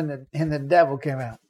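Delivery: ordinary delivery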